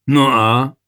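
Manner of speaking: loud, defiant retort; defiant sneer. A narrator's reading. Soldier shrugs off the king's disgrace, defiantly sneering. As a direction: throw it back with a rebellious sneer. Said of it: "ušklíbil se odbojně Prokop".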